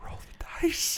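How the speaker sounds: whispered aside; whispered prompt. Real, not acted. Game master prompting the player, whispered.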